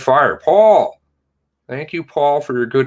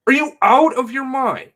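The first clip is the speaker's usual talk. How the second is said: outraged. Real, not acted. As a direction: hammer the question home in outrage. Outraged at the police chief.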